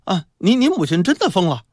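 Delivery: put-on high, urgent child's voice; insistent child. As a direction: insist like a kid breaking news. Child insists in acted story dialogue.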